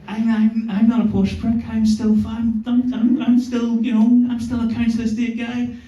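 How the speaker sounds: flustered pitch-up protest. Retold or imitated. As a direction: stammer out a panicked defence. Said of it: "I was like".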